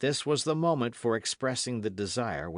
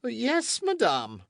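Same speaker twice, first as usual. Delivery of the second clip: high, louder startled reply; astonished king; 14 semitones higher, 5 dB louder, slower than usual. A narrator's reading. Astonished king answering the queen, startled. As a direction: caught off guard. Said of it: "replied the astonished king".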